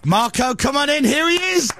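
high, excited host call; announcer welcome. Pretend. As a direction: announce the guest with fanfare. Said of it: "guess who's here".

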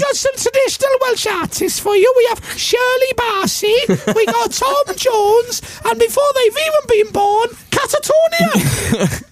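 put-on very high, excited girly announcer; little girl voice. Girl presenter announcing artists.

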